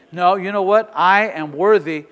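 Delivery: loud, insistent affirmation, slowing down; emphatic self-declaration. Acted. Preacher shows how to say it at work, emphatic self-declaration. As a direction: declare it to yourself with conviction.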